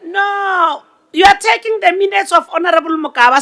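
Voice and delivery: normal talking voice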